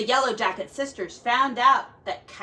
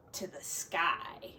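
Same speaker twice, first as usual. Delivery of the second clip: breathy whisper as Eagle flies away; 6 semitones lower, 11 dB quieter than usual. A narrator's reading. Storyteller whispering as Eagle soars into the sky. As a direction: let the words drift off to nothing.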